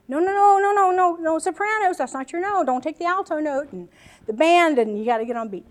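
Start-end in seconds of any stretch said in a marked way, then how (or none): 0.1-3.7 choir director. Retold or imitated. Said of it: "It's like".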